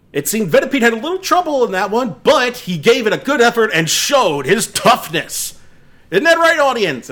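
high, loud showy announcer voice; contest announcer. Acted. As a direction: hype the crowd like a contest host.

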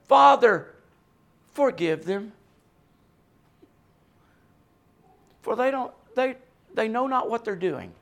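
tender plea. Retold or imitated. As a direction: plead gently for their forgiveness. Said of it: "he looks upon them and he says".

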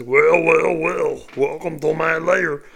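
loud, hammy cartoon villain; cheesy villain voice. Shown as a demonstration. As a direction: ham up a corny evil welcome. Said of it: "it doesn't fit. It's not smart".

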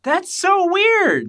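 very high, slow and loud; high squeak. Acted. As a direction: squeak it out slowly. Squeaky mock reaction.

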